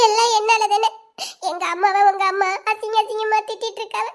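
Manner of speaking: distraught sobbing confession; tearful, gasping between phrases; crying. Acted. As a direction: pour it out through tears. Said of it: "நீ எதுக்கிட்ட அழுதுட்டு வர".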